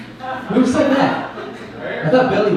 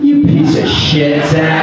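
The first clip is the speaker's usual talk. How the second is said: louder, slower mock insult; mock outrage; 4 dB louder, slower than usual. Comic mock-scolding audience member Zach, louder. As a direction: fake fury at the prankster.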